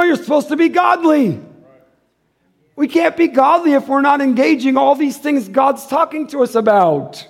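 normal talking voice

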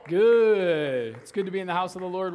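usual speaking voice

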